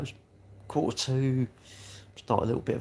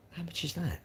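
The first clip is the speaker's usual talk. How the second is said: much softer, higher quoted reaction; retold hushed question; 7 semitones higher, 13 dB quieter than usual. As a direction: ask it small and high, as if quoting yourself. Retold reaction to pricey flat.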